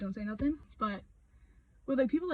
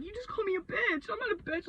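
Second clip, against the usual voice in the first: high, whiny mock protest; mock indignant denial; 9 semitones higher than usual. Woman mocking the protest she won't make, high and whiny. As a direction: pitch it way up like a huffy denial.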